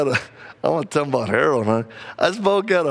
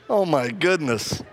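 normal talking voice

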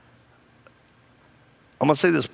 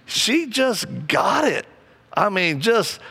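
normal talking voice

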